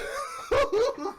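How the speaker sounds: high laugh; real laugh at own dumbness; laugh at himself. Real, not acted. Laughing at his own mistake, real laugh.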